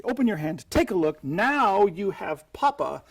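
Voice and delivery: bright, slowed showman patter to the spectator. Shown as a demonstration. Magician to spectator, revealing the swap with showman patter.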